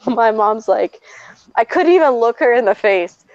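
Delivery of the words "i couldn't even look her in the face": normal talking voice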